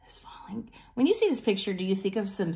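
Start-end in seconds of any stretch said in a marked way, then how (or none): 0.1-0.7 unvoiced storytime whisper. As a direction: breathe it dramatically. Dramatic whisper reading.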